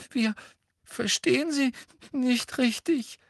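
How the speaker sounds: put-on high, halting, tearful girl's voice; sniffling. A narrator's reading. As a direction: squeak it out between sniffs. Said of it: "sagte Hermine laut schniefend".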